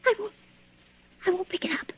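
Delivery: terrified whisper. Acted. Woman afraid of the listener downstairs, whispering. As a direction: breathe it so no one hears.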